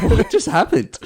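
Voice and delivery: high-pitched bewildered question; retold confusion; baffled surprise. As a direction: ask it in mock disbelief. Retold confusion after the ejection.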